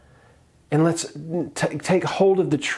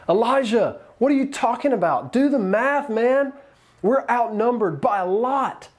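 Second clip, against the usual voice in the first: much higher, frantic disbelief; panicking servant; 9 semitones higher than usual. Retold or imitated. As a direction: play the servant in a panic, voice jumping up. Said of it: "and he's like".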